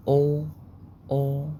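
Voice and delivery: ordinary talk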